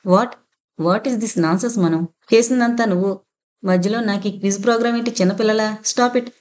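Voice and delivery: usual speaking voice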